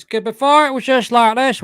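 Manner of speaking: high, loud put-on voice; Michelangelo. Shown as a demonstration. Showing how James used to sound, high loud voice. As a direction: push it up into a forceful character voice. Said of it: "where it's just like Michelangelo's".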